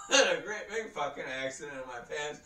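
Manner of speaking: ordinary delivery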